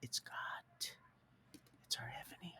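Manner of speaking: hushed, reverent whisper. Real, not acted. Man naming God to his viewers, whispering. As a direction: breathe it out in awe.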